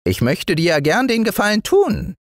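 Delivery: high, kindly little gnome; tiny woodland man giving in; good-natured dwarf. A narrator's reading. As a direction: relent gently in a piping voice. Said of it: "sagte er endlich gutmütig".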